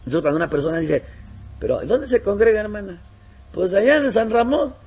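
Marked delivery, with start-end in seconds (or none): 1.6-4.7 mock dialogue. Acted dialogue about church distance.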